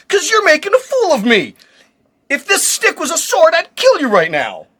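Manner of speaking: mock threat. Balaam threatens the talking donkey.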